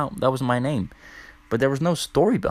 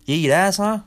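teasing accusation; 9 semitones higher, 5 dB louder than usual. Retold or imitated.

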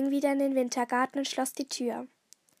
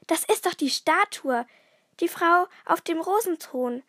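higher, louder cry of delight; delighted exclamation; 4 semitones higher, 5 dB louder than usual. A narrator's reading. Nico recognises the missing statue, calling out delighted. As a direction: exclaim it joyfully, raised in pitch and volume. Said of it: "rief er erfreut".

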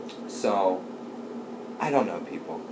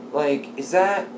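usual speaking voice

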